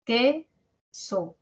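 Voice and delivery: "Que, so" as two separate syllables. Shown as a demonstration. Teacher splitting queso for students, "Que, so" syllable by syllable. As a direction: clear pause between "Que" and "so", second one falling. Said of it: "Sílabas".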